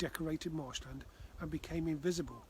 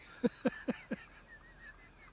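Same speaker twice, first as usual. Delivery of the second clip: put-on uncontrollable child giggle, higher; high giggling laugh; 9 semitones higher than usual. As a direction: laugh helplessly. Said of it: "laughed uncontrollably".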